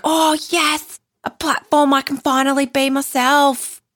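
high-pitched mock bogan Aussie gushing; bogan Aussie accent. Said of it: "It was like bogan Aussie".

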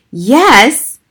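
high, loud shocked question; astonished. Acted. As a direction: gasp it in disbelief. Harriet astonished by the news.

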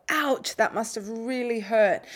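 slow, soft-hearted ouch; calm, slow sympathy. Shown as a demonstration. Parent comforting a hurt child, slow and gentle. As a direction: feel their hurt with them, slowly. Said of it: "use a calm, slow, quiet tone of voice".

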